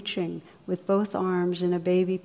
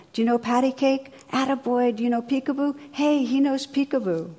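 shouting across the room; 6 semitones higher than usual. Retold or imitated. Old bum calls to a baby across the restaurant, mock shouting. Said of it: "the old bum was shouting from across the room".

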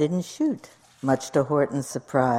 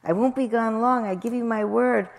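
high-pitched pleading bird; coaxing, promising bird voice; begging; 7 semitones higher than usual. A narrator's reading. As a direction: press the plea in a raised voice. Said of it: "begged the bird".

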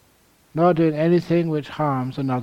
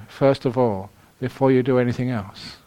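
ordinary delivery

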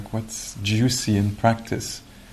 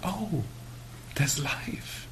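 soft, higher "oh" of wonder; hushed discovery; 7 semitones higher, 9 dB quieter, much slower than usual. Retold or imitated. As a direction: softly marvel at spotting a tiny creature. Reenacts noticing a breathing animal.

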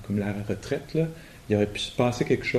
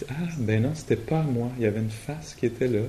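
usual speaking voice

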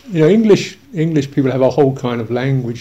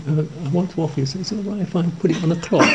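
hurried offering woman; 3 semitones higher, 6 dB quieter, faster than usual. Retold or imitated. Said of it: "there's this woman going".

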